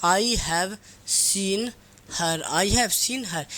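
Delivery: very slow, word by word, as if dictating; slow dictation of an example sentence; dictation pace. Shown as a demonstration. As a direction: stretch each word so students can write it. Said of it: "अगर मैं लिख देता हूँ".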